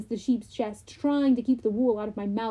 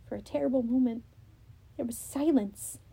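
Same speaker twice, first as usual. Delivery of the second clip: usual speaking voice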